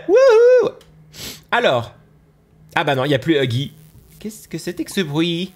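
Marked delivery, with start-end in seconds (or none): 0.1-0.7 loud, high cheer; excited whoop. Real, not acted. Thrilled player spotting a ball pit, whooping high and loud. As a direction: shout for joy at the ball pit.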